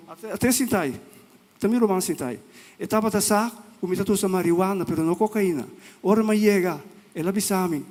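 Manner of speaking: normal talking voice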